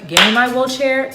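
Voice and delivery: retold demand. Retelling her demand to staff.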